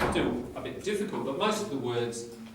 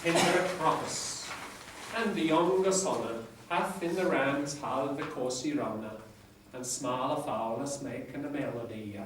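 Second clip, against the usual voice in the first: reading Chaucer aloud in Middle English pronunciation. Shown as a demonstration. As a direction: recite it like a lecturer reviving Chaucer's English. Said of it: "I can't pronounce it as well as my lecturer in Middle English, but I'll give it a go".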